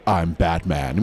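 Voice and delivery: low, deep heroic read; low Batman voice. Shown as a demonstration. At the read-through, deep heroic Batman voice. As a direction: drop it low and serious. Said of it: "I'm gonna do this nice low voice".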